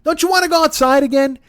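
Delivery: high, fast exasperated pleading. Real, not acted. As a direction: plead in disbelief.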